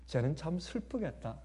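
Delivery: soft mother. Retold or imitated. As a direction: hush it like a kind mother. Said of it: "부드러운 어머니의 말".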